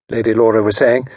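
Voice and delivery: ordinary delivery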